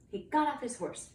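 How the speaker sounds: plain reading voice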